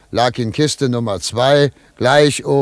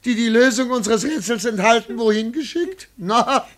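talking high through giggles; can barely contain the joke; delighted, laughing voice; gleeful laughter; 10 semitones higher than usual. Acted. Gleeful news to secretary.

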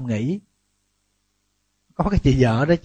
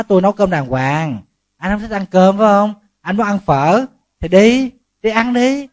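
higher, louder wife challenging her husband; wife talking back; 6 semitones higher, 4 dB louder than usual. Retold or imitated. Wife answers the husband who skips her cooking, higher and pointed. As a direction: let the wife speak her mind, pointed. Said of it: "Đó".

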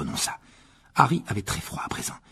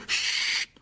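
voiceless, sustained shush; hushing. A narrator's reading. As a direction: shush them sharply to listen. Harry fait taire ses amis. (chut soufflé)

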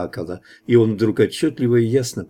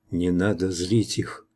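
soft, low, slow half-whisper; understated whispered caution, slowed down; 4 semitones lower, 6 dB quieter than usual. A narrator's reading. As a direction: murmur it slowly from behind someone. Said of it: "из-за спины прошептала Лана".

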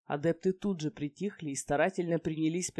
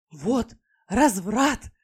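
slow, loud, gleeful exclamation; overjoyed; 5 semitones higher, 9 dB louder, much slower than usual. Acted. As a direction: relish every word with glee. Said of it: "не в меру радостный".

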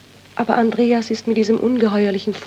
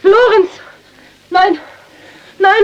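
screamed much higher and louder; horrified scream; 10 semitones higher, 6 dB louder, slower than usual. Acted. Widow cries out as Lorenz reaches for the film, screaming. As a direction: cry out in sheer horror.

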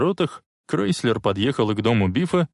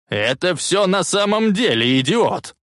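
high, loud furious shout; old man screaming at his grandson; 8 semitones higher, 3 dB louder than usual. A narrator's reading. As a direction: yell it with all his might. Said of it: "закричал он, что было мочи".

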